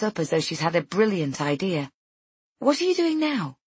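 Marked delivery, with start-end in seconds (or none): 2.6-3.6 high, eager question; sudden eagerness. A narrator's reading. Friend struck by an idea, suddenly eager. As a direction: pitch it up with sudden keenness.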